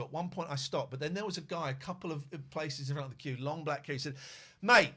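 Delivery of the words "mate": high, very loud yell; imitating a loud threat; threatening shout. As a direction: warn him off loudly. Stranger threatening the man.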